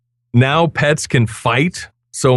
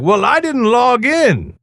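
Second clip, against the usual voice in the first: mock complaining; 7 semitones higher than usual. Host imitating complaining players, high mock whine. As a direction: mimic an upset player, pitched up. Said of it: "some complaints because some people were like".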